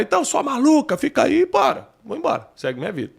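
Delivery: mock angry retort. Imagined storming-off retort to wife.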